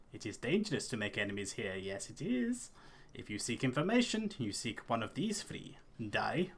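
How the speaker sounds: old Dunlending lady, lightly voiced, half hushed; a touch of Dunlending accent, mysterious and warning; old clanswoman warning a traveller in a light Dunlending accent. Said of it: "Dunlending accent".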